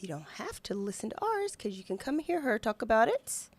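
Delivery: ordinary talk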